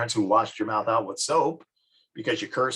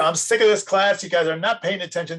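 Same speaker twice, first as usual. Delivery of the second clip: higher, louder fed-up teacher; exasperated teacher; 7 semitones higher, 4 dB louder than usual. Acted. Teacher scolding an inattentive class, exasperated. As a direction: scold a class that won't listen.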